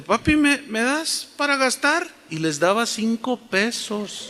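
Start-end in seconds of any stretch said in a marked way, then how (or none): 0.0-2.1 put-on high, coaxing girl's voice; sweet-talking daughter. Sister asking papi for spending money, high and coaxing. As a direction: coax daddy in a raised pitch. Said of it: "le decía".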